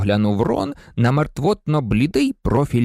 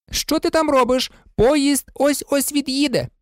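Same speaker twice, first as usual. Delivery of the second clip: high, agitated wife's call; calling out urgently; 12 semitones higher than usual. A narrator's reading. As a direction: hurry your husband along in a high voice. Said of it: "гукнула місіс Візлі". (нетерплячий високий оклик)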